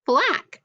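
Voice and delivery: Flack said high and bright; modelled word. Shown as a demonstration. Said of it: "Change it to a full".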